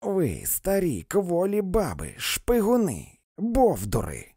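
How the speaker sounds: rising to a high, loud yell; high yelled abuse, slow; yelling insults. A narrator's reading. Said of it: "волала вона".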